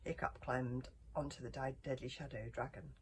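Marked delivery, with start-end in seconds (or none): none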